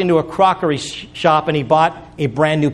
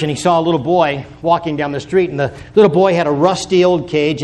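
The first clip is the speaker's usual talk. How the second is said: ordinary delivery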